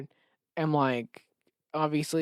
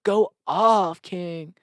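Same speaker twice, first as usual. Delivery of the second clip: hype bro; 2 semitones higher, 5 dB louder, much slower than usual. Retold or imitated. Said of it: "Colin going".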